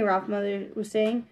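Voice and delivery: usual speaking voice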